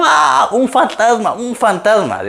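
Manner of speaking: high-pitched mock shriek of panicked apostles; hammed-up terrified scream. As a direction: scream it in comic panic, stretching the first cry. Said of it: "los doce apóstoles gritando". (grito de pánico exagerado en broma)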